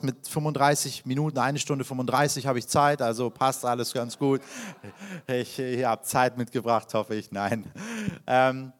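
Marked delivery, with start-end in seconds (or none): none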